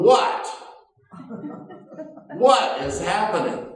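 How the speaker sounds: loud, high what; stunned what is happening; bewildered. Retold or imitated. Bewildered reaction.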